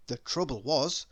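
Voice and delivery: usual speaking voice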